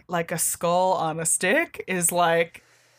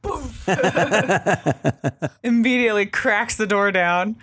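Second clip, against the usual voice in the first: usual speaking voice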